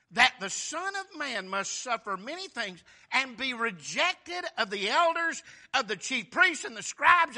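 measured, rising scripture read; solemn scripture reading. Acted. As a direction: read the verse like a preacher. Reads scripture in sermon.

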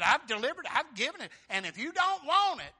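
loud, high, rising indignation; loud rebuke. Acted. Paul rebuking the opposing crowd, loud and high. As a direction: hurl it at the crowd.

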